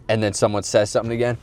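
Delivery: ordinary delivery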